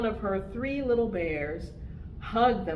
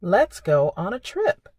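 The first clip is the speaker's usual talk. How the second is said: put-on reading to children; 7 dB louder than usual. Reads picture book to children.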